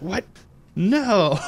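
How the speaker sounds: high, drawn-out cartoon disbelief; mock shocked outcry. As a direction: imitate a villain's dismay, voice leaping up. Retold villain's shock at losing.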